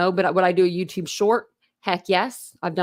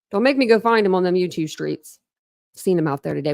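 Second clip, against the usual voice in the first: ordinary talk, nothing marked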